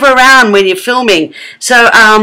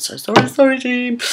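sheepish apology; 5 semitones higher, 5 dB quieter than usual. Retold or imitated. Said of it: "went and apologised for the team".

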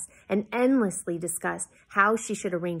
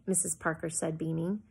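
usual speaking voice

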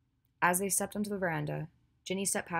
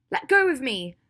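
loud, high demand to be released; angry shout; 8 semitones higher, 12 dB louder than usual. Acted. Ginny is held back from attacking Draco, shouting angrily. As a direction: yell it while struggling to break free.

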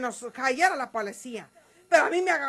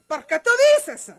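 higher, sharp demand; indignant challenge; 8 semitones higher, 6 dB louder than usual. Acted. María Eugenia challenges Gumaro's claim, indignant. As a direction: snap back in disbelief. Heated exchange, pitch jumps.

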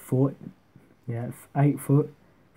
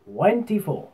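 higher darts caller's score call; referee voice; 6 semitones higher, 3 dB louder, slower than usual. Shown as a demonstration. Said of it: "get our referee voice on".

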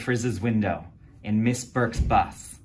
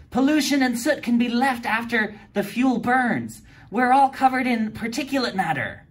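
high, eager explaining voice; girl reading from her research book; know-it-all girl; 12 semitones higher, 5 dB louder than usual. A narrator's reading. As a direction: read it out like the class expert. D A explains to the class.

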